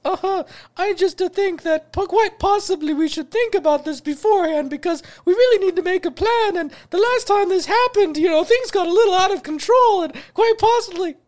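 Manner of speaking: very high, loud falsetto cartoon voice; squeaky cartoon plumber rambling on; Mario. Cartoon plumber answering the call to save the princess, hammed-up falsetto. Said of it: "Hey, Mario".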